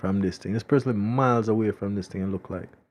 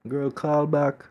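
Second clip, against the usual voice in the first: usual speaking voice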